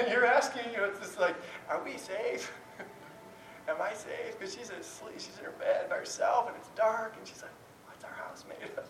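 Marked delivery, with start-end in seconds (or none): 1.7-4.4 very soft, timid question; small scared child. Retold or imitated. Daughter alone in bed asks if she's safe, hushed worry. 7.9-8.8 barely audible whispered question; child's whisper. Retold or imitated. Daughter asks from her bed in the dark, whispered.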